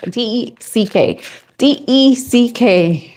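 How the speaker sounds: slowly spelling "D E C K" letter by letter; spelled out. Shown as a demonstration. Showing viewers how to say deck, slowly spelling it out. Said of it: "I hear people say that word".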